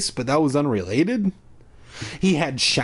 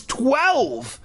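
blurted out; 10 semitones higher than usual. A narrator's reading. Terrified kid blurts answer.

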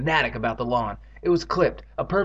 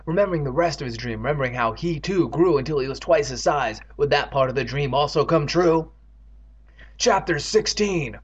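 ordinary delivery, nothing marked

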